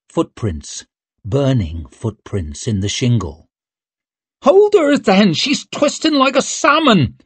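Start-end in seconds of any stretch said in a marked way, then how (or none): 4.4-7.2 high, loud straining voice of a helper; rough helper. Acted. Helper holding Rika down calls for help, high and loud. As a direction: call out while wrestling her still.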